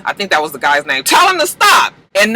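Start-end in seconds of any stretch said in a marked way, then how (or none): 1.0-1.9 high, loud retold shout; acted urgent shout, pitched way up; calling out. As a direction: yell it out as if halting someone. Acts out a man calling out.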